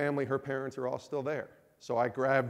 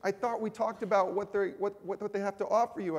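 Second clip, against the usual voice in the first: upset wife; 7 semitones higher than usual. Retold or imitated. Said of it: "But she's like".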